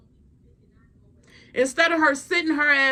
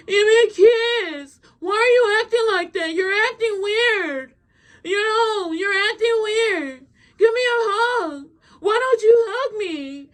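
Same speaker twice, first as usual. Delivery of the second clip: tearful begging; 9 semitones higher, 3 dB louder than usual. Retold or imitated. Girlfriend begging her boyfriend for a hug, mock tearful wail. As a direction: imitate a clingy girlfriend wailing for affection.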